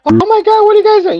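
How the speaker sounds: very high, loud panicked woman voice; mock shrieking lady. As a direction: shriek it like a startled bystander. Voicing the shocked lady.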